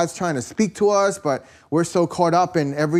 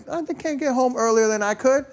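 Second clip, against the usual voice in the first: much higher, put-on voice mocking himself; mock complaining voice; 9 semitones higher than usual. Pastor mocking his own grumbling at the store, high put-on voice. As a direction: squeak it out like a mock self-pity. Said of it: "I was like".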